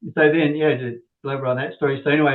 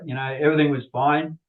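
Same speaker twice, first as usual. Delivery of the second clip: ordinary talk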